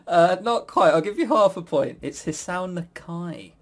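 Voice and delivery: normal talking voice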